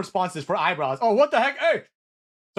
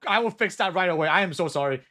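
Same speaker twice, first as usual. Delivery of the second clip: usual speaking voice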